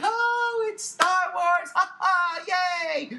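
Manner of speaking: high, loud mock cheer with forced laughing; hammed-up phony host enthusiasm; fake excitement. Mimicking convention hosts to the viewers, fake over-the-top glee. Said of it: "this shit was all fake".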